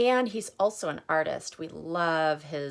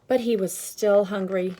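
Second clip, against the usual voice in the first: louder, slowed, emphatic picture-book reading with the hungry refrain swooping up; reading to children; 4 semitones higher, 7 dB louder than usual. Acted. Teacher reading aloud to children, dramatic refrain. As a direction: make every day a build to "still hungry".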